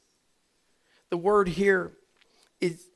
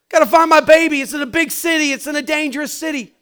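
high, loud, panicked mother's cry; frantic mother; 10 semitones higher, 13 dB louder, faster than usual. Retold or imitated. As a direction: cry out like a mother who lost her child. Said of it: "this lady is going crazy".

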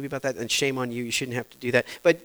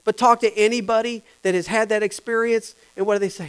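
ordinary talk, nothing marked

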